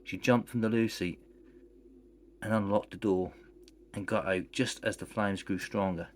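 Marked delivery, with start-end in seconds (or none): none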